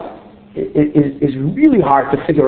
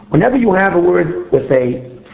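ordinary talk

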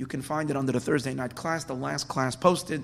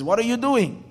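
higher, louder incredulous question; baffled wolf; 8 semitones higher, 6 dB louder than usual. Acted. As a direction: call down in astonishment, pitch jumping up. Wolf puzzled at fox's leap.

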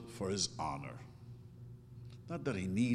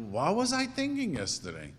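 mock disbelief, pitched much higher; baffled at myself; 9 semitones higher than usual. Rabbi voicing himself puzzled at yesterday's thoughts, high surprise. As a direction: wonder at your own yesterday self. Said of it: "I wake up in the morning and I think".

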